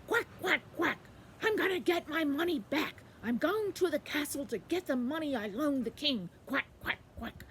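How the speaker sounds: high, loud quacking duck voice. Acted. Quackling telling the bees his errand, high loud duck voice. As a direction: announce it forcefully in quacks. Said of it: "he said".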